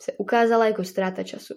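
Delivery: ordinary delivery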